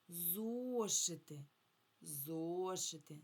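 "Зошити" slowly, with a long stressed о; stress on first syllable. Shown as a demonstration. Teacher asking children to find the stress, long о in "Зошити". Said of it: "На який склад падає наголос".

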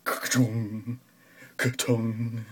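ordinary talk

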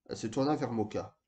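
plain reading voice

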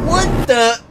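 high, loud startled yell. A narrator's reading. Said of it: "he yelled".

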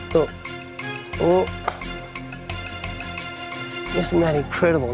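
ordinary talk, nothing marked

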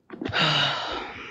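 long soft sigh. Real, not acted. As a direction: let out a tired sigh. Before the second gummy.

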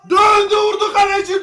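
loud high commentary yell; excited shout. Real, not acted. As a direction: shout as the shot goes. Shot saved.

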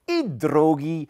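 put-on slow, stretched childish exclamation; drawn-out naive excitement; little hare's cry. A narrator's reading. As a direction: call it out as a delighted little hare. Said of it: "krzyknął na widok piątego".

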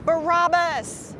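high, drawn-out narrated shout; restrained mob cry, high and stretched; crowd's shout. A narrator's reading. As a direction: call it out slowly like a crowd. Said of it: "the crowd shouted back".

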